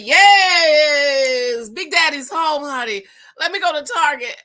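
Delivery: high, loud excited cry of joy; giddy high welcome-home shout; excited squeal. Retold or imitated. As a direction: squeal with delight as he walks in. Excited greeting of husband home.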